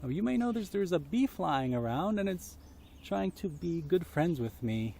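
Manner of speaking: plain reading voice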